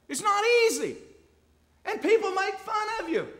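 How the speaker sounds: loud, high preacher's cry; fiery preaching. Acted. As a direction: drive it home to the church. Preaching at full force.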